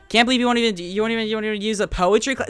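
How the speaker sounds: booming divine voice, half joking; God's voice. Pretend. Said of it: "Non-denominational God just whispered in my ear and said".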